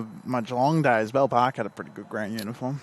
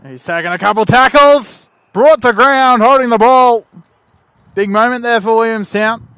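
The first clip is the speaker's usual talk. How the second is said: much louder and higher match call; thrilled, full-voiced football commentary; excited commentary shout; 9 semitones higher, 16 dB louder than usual. Real, not acted. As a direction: shout the big tackle as it happens. Commentator calls a big tackle.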